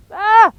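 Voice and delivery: sharp high gasp from icy cold; gasp of cold shock. Real, not acted. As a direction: yelp at the sudden chill.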